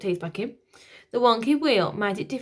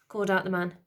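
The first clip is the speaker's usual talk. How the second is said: ordinary delivery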